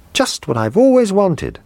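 put-on gushing child thrilled with a gift; delighted boy. A narrator's reading. Boy thanking for birthday present, high delighted. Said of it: "he cried".

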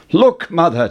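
eager call to mother; excited cry. A narrator's reading. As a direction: show mother the hen proudly. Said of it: "Jack cried".

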